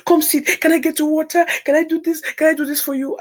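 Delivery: high, eager offers of help; caring friend. Retold or imitated. Friend fussing over a tearful Rosie, pitched high and eager. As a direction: fuss over her like a worried friend. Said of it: "'cause she's that kind of caring person".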